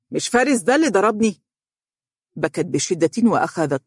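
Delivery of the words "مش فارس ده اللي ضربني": higher, quick indignant retort; resentful. A narrator's reading. Husband recalls who hit him, resentful. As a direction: throw the grievance back at her. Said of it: "هتف بها حانقا".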